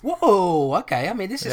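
mock shock, hands up; taken aback. The guy reacting to being sworn at, mock startled retreat.

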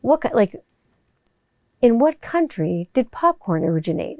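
half-whispered trivia voiceover; whispered quiz read. Shown as a demonstration. Mimicking a trivia vocal drop, half whispered. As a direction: breathe the question like a quiz host. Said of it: "Or, like".

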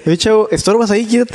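mock curt girl telling him to move; rude stranger. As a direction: brush him off like a bored stranger. Stranger shooing him away.

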